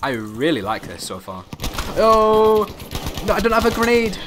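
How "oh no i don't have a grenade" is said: loud high yelp of alarm; panicked shout. Real, not acted. Caught without a grenade.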